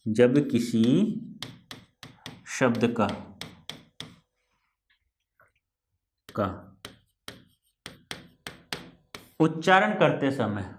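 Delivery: slow, word by word, for writing down; dictating the rule slowly; slow dictation. Shown as a demonstration. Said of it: "तो क्या कहा मैंने?".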